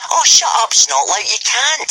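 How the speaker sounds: high, loud scornful retort; mocking retort. A narrator's reading. Speedy Fist mocking Snotlout's boast, scornful.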